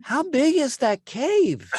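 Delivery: high, slow squeaky little voice; squeaky gnome voice. Retold or imitated. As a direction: pitch it way up, slow. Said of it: "you hear Kyle go".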